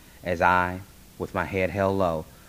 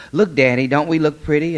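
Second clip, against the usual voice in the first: high, loud, eager show-off; excited little girl; 9 semitones higher, 9 dB louder than usual. Acted. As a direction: call out proudly to Daddy for a compliment. Little sister showing off her dress.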